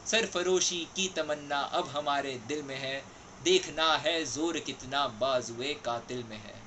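measured, declaimed patriotic verse, swelling on the defiant lines; poem recitation. Acted. Reciting a favourite Urdu poem to listeners, declaimed poem recitation. ये कविता का शीर्षक है सरफरोशी की तमन्ना.

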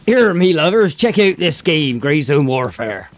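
slow, hearty West Country pirate; Bristolian pirate accent. Pretend. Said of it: "None would sound like a pirate".

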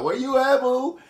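mock-sweet high-pitched summons; woman about to win. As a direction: imitate a woman cooing for her boo. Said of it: "that's how they talk".

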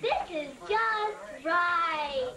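very slow, drawn-out verdict; satisfied story girl. Acted. Child retelling Goldilocks tasting baby's porridge, satisfied story girl. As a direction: stretch each word out with relish.

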